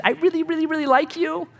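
high-pitched, gushy mock newlywed; gushing homemade vows. Bride or groom reciting homemade vows, mock gushing in a high voice. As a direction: imitate a sappy couple reading their vows. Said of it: "the vows are like this".

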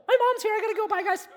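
high, chirpy mock kid getting picked up; middle schooler voice. Pastor mocks himself as a middle schooler, high kid voice. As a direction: play a middle school kid leaving with mom. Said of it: "I feel like I'm in middle school".

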